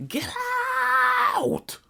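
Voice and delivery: long, drawn-out high yell; mock angry yell. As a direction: imitate a fed-up teacher ordering out. Teacher throwing him out, voiced.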